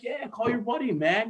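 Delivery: imitating young boss. Boss telling intern to go out, mock high voice. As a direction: play the chill boss waving him off. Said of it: "He's like".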